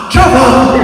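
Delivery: loud joyful cry of a name; excited cry. Retold or imitated. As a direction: shout the name in delighted recognition. Gran recognising grandson.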